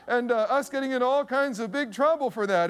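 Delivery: ordinary delivery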